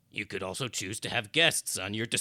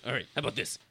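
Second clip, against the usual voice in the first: usual speaking voice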